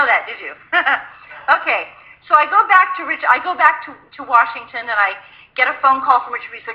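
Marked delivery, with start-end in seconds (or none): none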